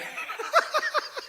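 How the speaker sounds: high-pitched amused laugh; the speaker cracking up at the story's twist, laughing high; high burst of laughter. Real, not acted. Teller laughs at own story.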